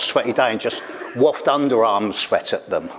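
usual speaking voice

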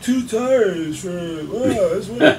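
lightly voiced spaced-out stoner, slow on the tires line; a hint of a dopey stoner sneering at the idea; mock stoner. Mocking the lawyer who took him for a stoner, lazy stoner drawl. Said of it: "like, I'm some stoner".

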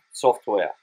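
normal talking voice

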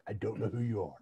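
a hint of a low menacing threat; lightly voiced movie tough guy; Liam Neeson in Taken. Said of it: "I get like Liam Neeson in, like, Taken".

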